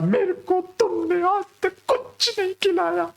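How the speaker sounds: put-on high child voice wailing in a tantrum; boy lying on the mall floor wailing at mom; tantrum yell. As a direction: cry it out like a kid kicking on the floor. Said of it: "चिल्ला के कहता है".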